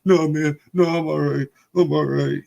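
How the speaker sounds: high voice, mimicking a kid catching his breath; imitated tearful bravery. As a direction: act out a crying boy insisting he's fine.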